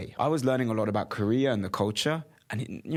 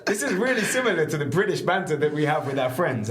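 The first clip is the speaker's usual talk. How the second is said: laughing; 5 semitones higher, 4 dB louder than usual. Retold or imitated. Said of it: "it's like".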